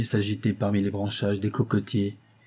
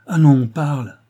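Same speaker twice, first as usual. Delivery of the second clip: louder, higher insistent prompt; pressing urging; 4 semitones higher, 8 dB louder than usual. A narrator's reading. Worried husband urges his silent wife to speak, pressing her. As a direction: press for an answer. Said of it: "pressa-t-il".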